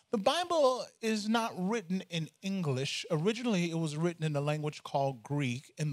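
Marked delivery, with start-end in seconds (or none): none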